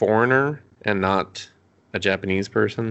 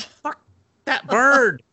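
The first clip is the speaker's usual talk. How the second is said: high, loud burst on BIRD; mock exasperation; 4 dB louder, much slower than usual. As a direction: curse the bird in comic outrage.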